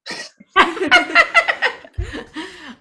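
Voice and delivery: high, loud burst of laughter; laughing. Real, not acted. Teacher after banter with a singer, loud laughing.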